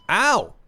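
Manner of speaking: sharp loud pained yelp; sudden yelp. Real, not acted. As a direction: yelp of pain, high and loud. Hit by a bug.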